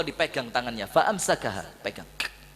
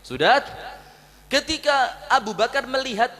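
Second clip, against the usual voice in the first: ordinary talk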